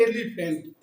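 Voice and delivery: एलिफेंट with a clear opening ए; stress on the e. Shown as a demonstration. Teacher showing the vowel start of elephant. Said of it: "यहाँ ए है या ए है".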